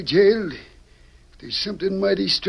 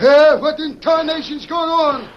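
put-on higher, louder, outraged old storekeeper; indignant; 7 semitones higher, 6 dB louder than usual. Storekeeper in nightshirt woken by shooting, indignant outburst. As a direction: bristle at being woken by gunfire. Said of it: "with indignation written in every line".

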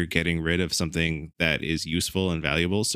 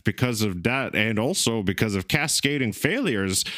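high, laughing Italian accent; hammed-up Italian accent; 4 semitones higher than usual. Shown as a demonstration. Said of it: "Main speaking Italian".

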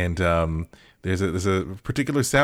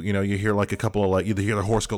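ordinary delivery

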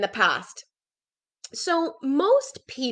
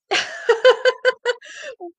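short high laugh; bright laugh; 13 semitones higher, 5 dB louder than usual. Real, not acted. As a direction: laugh it off lightly. Laughs at her own stuffiness.

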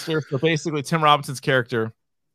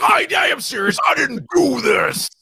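mock protest; 6 semitones higher than usual. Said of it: "He's like".

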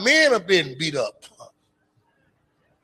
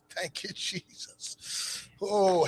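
normal talking voice

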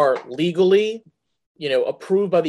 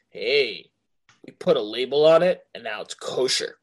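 mock official; 5 semitones lower, 3 dB quieter than usual. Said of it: "the F D A was like".

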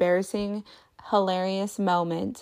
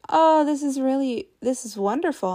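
higher, louder gushing over a gift; gushing delight; 6 semitones higher, 3 dB louder than usual. Retold or imitated. Mom thrilled by the daughter's book, gushing. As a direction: rave about the sweet book. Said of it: "And I was like".

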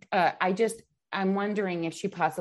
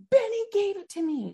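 put-on much higher, insisting child repeat; insistent daughter; 12 semitones higher than usual. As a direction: pitch it up high like a stubborn kid. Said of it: "She was like".

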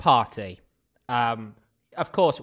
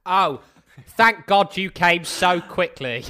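mock relief; 5 semitones higher, 6 dB louder, slower than usual.

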